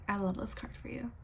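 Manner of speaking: soft unvoiced whisper. Real, not acted. Whispering to the Virgo viewers. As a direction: breathe it like a secret.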